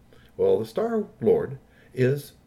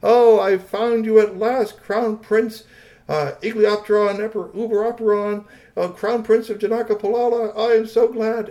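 high, loud, proclaiming alien lord; joyful Star-Lord; 11 semitones higher, 7 dB louder than usual. A narrator's reading. Star-Lord finds the crown prince at last, joyful proclaiming. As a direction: hail your lost prince in a raised voice.

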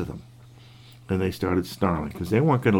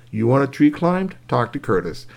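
ordinary delivery

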